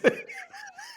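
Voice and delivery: high squeaky burst of laughter; real laugh. Real, not acted. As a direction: crack up at the picture. Laughing at the story.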